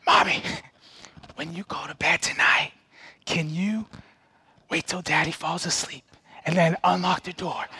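put-on child's stage whisper, secretive; little boy whispering a sneaky plan to mom; loud whisper. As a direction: hush it like a kid hoping Daddy won't hear. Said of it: "He's whispering".

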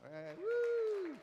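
high whooping cheer. Retold or imitated. Coach reliving senior night win, high loud whoop. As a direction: whoop like the buzzer just went.

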